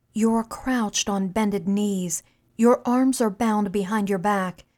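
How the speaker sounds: ordinary delivery, nothing marked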